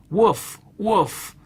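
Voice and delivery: a storyteller's dog bark. A narrator's reading. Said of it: "And the dog barked".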